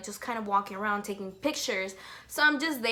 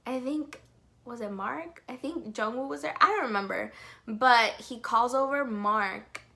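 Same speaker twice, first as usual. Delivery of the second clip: usual speaking voice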